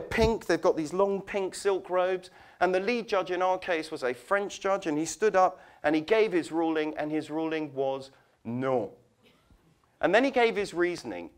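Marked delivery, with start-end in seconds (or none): none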